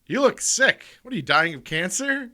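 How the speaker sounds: loud, high tactless blurt. Retold or imitated. Girl blurts rude question in story.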